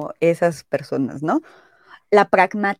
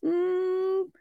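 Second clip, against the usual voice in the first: higher pitched, hesitant, unconvinced; reluctant approval; 10 semitones higher than usual. Retold or imitated. Friend grudgingly answering if the outfit fits, hesitant. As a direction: stall before admitting it. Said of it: "el tonillo es como de".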